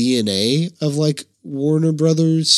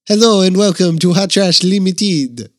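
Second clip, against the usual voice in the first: put-on higher, louder French-accented host; confident mock-French show intro; 5 semitones higher, 4 dB louder than usual. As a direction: present the show in hammed-up French. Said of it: "I can do it now".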